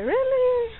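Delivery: high sarcastic really; incredulous scoff. Real, not acted. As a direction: scoff at the idea, pitch way up. Mocking celebrity makeup in labor.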